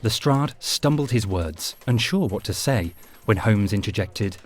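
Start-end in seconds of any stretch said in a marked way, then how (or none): none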